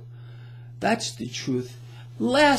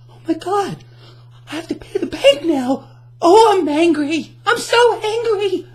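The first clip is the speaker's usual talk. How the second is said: higher and louder hammed-up shock that builds into mock anger; mock shocked outrage; 10 semitones higher, 7 dB louder than usual. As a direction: play a shocked bank customer who gets louder and angrier. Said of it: "The shocked face".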